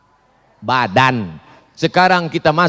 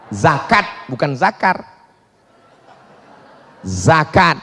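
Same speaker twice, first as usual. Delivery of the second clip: normal talking voice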